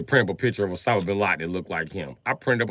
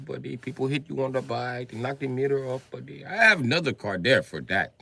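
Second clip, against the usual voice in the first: lightly higher mock Indian accent, chatty; 4 semitones higher than usual. Rich friend explains Indian roads, in a light mock accent. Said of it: "He was like".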